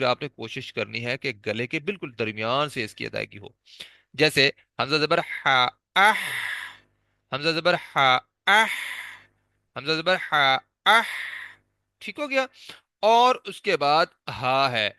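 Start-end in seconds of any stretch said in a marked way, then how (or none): 4.1-11.0 اح said with a stopped throaty h after a short a; spelling drill. Shown as a demonstration. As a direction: spell out حمزہ زبر ح, then اح from mid-throat. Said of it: "ہا کو ساکن کر لیں تو پھر آپ کو واضح پتہ چلے گا".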